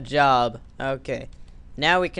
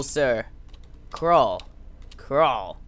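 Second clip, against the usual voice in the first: normal talking voice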